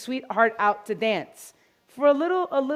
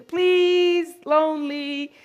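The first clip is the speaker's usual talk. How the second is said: high, louder pleading cry; begging shampoo; 5 semitones higher, 4 dB louder, much slower than usual. Acted. Groomer voicing the shampoo begging to be picked, high plea. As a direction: plead like a lonely bottle. Bottle begs to dance.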